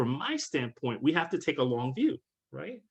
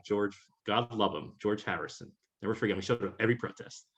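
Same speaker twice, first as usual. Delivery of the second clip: ordinary delivery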